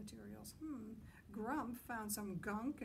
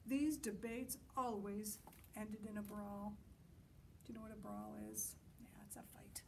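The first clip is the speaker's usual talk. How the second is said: ordinary delivery, nothing marked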